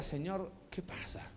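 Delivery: softly whispered plea to God; quiet pleading whisper; hushed prayer. Acted. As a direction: breathe the question like a secret prayer. Man whispering his prayer to God.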